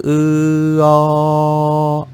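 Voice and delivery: E held very long, sliding open into O; continuous opening vowel. Shown as a demonstration. Teacher demonstrating for learners, one continuous opening vowel. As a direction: keep E going, then open it to O without a break. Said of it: "continuer à prononcer E".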